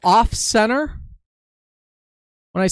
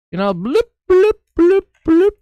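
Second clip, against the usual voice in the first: bouncy bloops popping much higher and louder; playful bloop noises; 10 semitones higher, 5 dB louder than usual. Shown as a demonstration. Teacher voicing cloud bumps while drawing, playful bloops. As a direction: pop each bloop out like a cloud puff.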